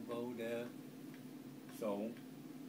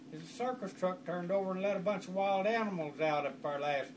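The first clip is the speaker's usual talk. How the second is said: calling mother voice; 8 semitones higher, 6 dB louder than usual. Retold or imitated.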